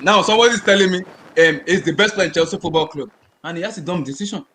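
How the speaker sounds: ordinary delivery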